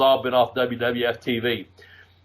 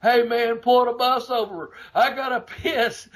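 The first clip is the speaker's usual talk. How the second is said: hammed-up Junkyard Dog wanting to pee; mock Junkyard Dog; 9 semitones higher than usual. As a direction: beg high like a desperate drinker. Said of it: "Junkyard Dog one".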